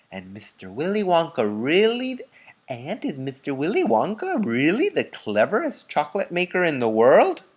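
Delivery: put-on an eager little boy asking; curious child's question; Charlie asking eagerly. A narrator's reading.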